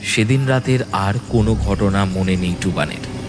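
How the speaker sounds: plain reading voice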